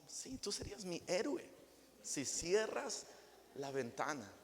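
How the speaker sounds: wife sweetly asking husband to close the window; sweet soft request. Acted. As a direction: ask sweetly, flattering him. Sweet gentle request to spouse.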